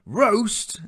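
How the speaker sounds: very high, loud alarmed cry; alarmed echo. A narrator's reading. Ogre's wife panicking as ogre nears the oven, very loud. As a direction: shriek the word in alarm. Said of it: "echoed the ogre's wife".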